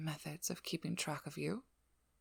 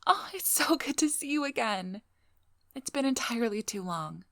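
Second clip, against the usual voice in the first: delighted, higher and louder hello; thrilled to see you, pitched up; gushing greeting; 6 semitones higher, 6 dB louder than usual. Acted. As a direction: greet a date with bubbly delight.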